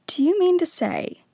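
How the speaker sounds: plain reading voice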